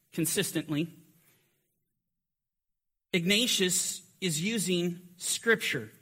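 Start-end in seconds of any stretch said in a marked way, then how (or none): none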